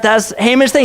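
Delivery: ordinary talk, nothing marked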